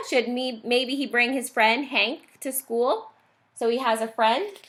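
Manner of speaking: ordinary delivery, nothing marked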